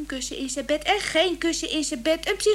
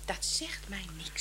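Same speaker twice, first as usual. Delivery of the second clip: whisper; 7 semitones lower, 9 dB quieter than usual. Acted. Wife dismisses fame in whisper.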